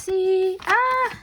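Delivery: high, slow, thrilled opening; gleeful unboxing squeal; excited squeal. Real, not acted. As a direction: squeal with delight as you open it. Opening happy mail excitedly.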